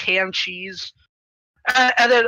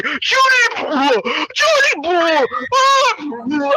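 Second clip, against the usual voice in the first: mock panicked cries; 7 semitones higher, much slower than usual. Players calling Judy as fireballs hit them, mock screams. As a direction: cry the name high, then get cut off.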